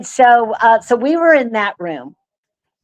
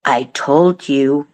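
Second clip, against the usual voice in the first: spirit box voice; 6 semitones lower, slower than usual. Retold or imitated. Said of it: "Exactly what came out of Dale's mouth".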